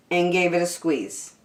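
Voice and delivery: ordinary delivery, nothing marked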